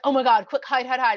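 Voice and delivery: loud, hurried alarm; urgent, hammed-up scramble to hide; mock panic. Humans hear Hashem coming and hide, mock panicked.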